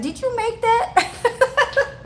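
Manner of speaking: high, drawn-out mock question, on the edge of laughter; imitating Larry's question. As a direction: squeal it slowly as a teasing imitation. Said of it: "He'll always be asking".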